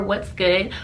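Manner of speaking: ordinary talk, nothing marked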